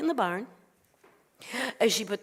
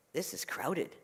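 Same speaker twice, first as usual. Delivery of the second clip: low, soft remark; note it quietly, pitched down; understated rabbi; 5 semitones lower, 9 dB quieter, slower than usual. Acted. Rabbi looks round crowded house, understated surprise.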